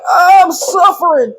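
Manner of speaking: high, drawn-out comic wail; hammed-up moan of suffering; mock agony. Retelling burning arms after carrying.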